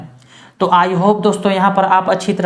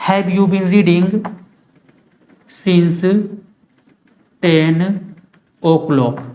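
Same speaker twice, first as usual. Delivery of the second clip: slow dictation; much slower than usual. Shown as a demonstration. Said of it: "वहाँ पर since का use किया जाता है".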